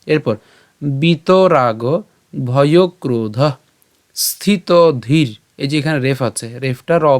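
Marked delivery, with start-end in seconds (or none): 0.8-5.4 very drawn-out, chunked Sanskrit words; slow model pronunciation. Shown as a demonstration. Teacher models the next words for learners, slow and drawn out. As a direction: stretch each word, pausing after it.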